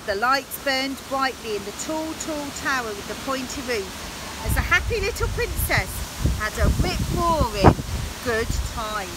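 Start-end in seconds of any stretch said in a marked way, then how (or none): none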